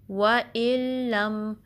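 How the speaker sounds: very slow, drawn-out recited phrase; slow tajweed recitation; Quranic recitation. Shown as a demonstration. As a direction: chant it slowly and evenly.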